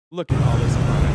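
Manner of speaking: roaring laugh over the words; mocking laughter. Real, not acted. Streamer laughing hard at footage of the crowd. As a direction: break into loud laughter.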